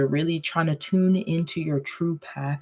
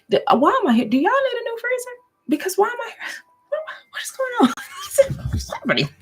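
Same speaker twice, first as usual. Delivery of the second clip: much higher, fast, squeaky silly character voice; goofy put-on voice; faster than usual. Tarot reader startled by a freezer card, in a goofy high voice. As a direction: pop into a cartoonish high voice, laughing through it. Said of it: "I just break out into, like, different accents".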